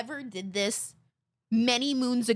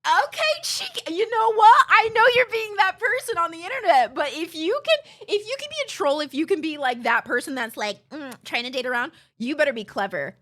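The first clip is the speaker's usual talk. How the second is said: much higher and louder, amused exasperated retort; amused exasperation; 6 semitones higher, 5 dB louder than usual. Retold or imitated. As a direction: throw back a high, loud, mocking challenge. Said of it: "And I was like".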